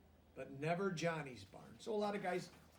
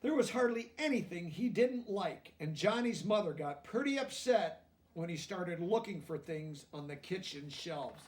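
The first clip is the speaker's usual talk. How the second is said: lively storybook reading aloud; reading to children; 3 semitones higher, 7 dB louder than usual. A narrator's reading. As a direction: read it out to the class, bright and clear.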